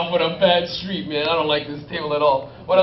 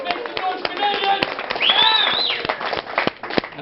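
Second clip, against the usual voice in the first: crowd hype; 8 dB louder, much slower than usual. Real, not acted. Hyping Canadians to cheer.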